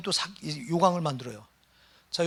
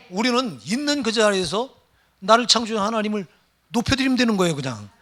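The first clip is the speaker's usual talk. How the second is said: louder, higher preaching push; fervent preaching; 4 semitones higher, 8 dB louder than usual. Acted. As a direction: drive the point home to the congregation. Preaching at full force. (열정적인 설교)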